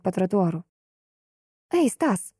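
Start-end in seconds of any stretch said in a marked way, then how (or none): 1.7-2.3 raised pitch, calling across the street. Acted. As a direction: call out to him. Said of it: "зову я". (окликает громко)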